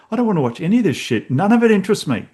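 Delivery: fast, high exasperated rant. Retold or imitated. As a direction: brush it all off, fed up. Irritated at TikTok.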